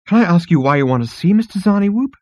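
high, rising question; curious insect receptionist. Acted. Insect receptionist questions departing Zaphod, high and curious. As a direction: ask timidly in a high voice.

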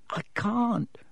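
sorry elf. Acted. Dobby refusing.

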